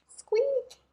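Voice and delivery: very high squeak; mouse squeak. Acted. The hidden mouse squeaking at Owl, high and tiny.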